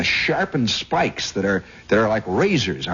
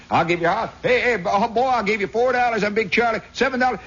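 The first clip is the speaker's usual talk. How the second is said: high-pitched betting shouts; imitated bettors hollering; hollered bets; 11 semitones higher than usual. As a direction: call out wagers over a crowd. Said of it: "these guys start hollering".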